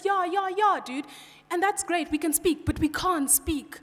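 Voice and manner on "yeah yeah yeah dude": high, loud casual dude voice; mock the laid-back schoolkid talk; imitating school friends, higher and louder; imitating school slang. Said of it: "then we began speaking like this".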